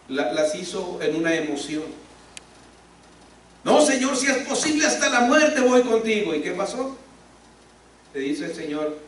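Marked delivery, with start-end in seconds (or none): 3.6-6.3 much higher, earnest vow; impulsive Peter. Retold or imitated. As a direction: swear it in the heat of emotion. (Pedro promete con emoción, voz mucho más aguda)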